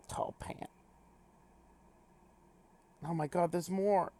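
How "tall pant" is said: whispered "Tall pant". Acted. Whispered item name.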